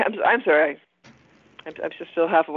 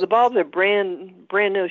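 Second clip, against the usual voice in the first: very slow; much slower than usual. Real, not acted. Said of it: "Can you slow down a little bit there".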